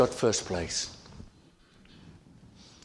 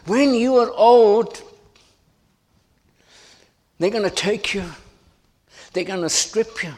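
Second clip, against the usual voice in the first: higher and louder, building intensity; impassioned prophecy; 8 semitones higher, 5 dB louder than usual. Acted. Jesus warning Peter he will die, impassioned. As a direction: warn him of his fate with fervour.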